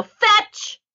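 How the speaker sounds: high, bright "Fetch"; called command. Acted. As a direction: call it out for the dog. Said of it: "And I go".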